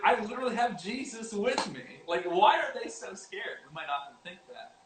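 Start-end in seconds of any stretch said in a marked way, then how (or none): 0.0-3.6 mock indignant. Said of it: "I'd be like".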